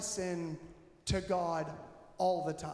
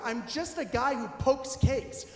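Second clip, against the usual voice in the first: ordinary talk, nothing marked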